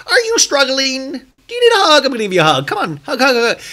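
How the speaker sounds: very high sing-song mock sweetness; condescending, hammed up. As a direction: overdo the patronizing hug offer. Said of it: "sounds so condescending".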